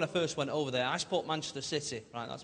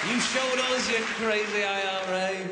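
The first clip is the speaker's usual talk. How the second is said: mock scolding; 3 semitones higher, 5 dB louder, slower than usual.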